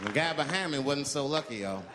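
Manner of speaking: ordinary talk, nothing marked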